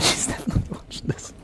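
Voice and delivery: whispered aside, no voice at all. Real, not acted. Teasing aside about a fan watching, whispered. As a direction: breathe it as a secret while laughing.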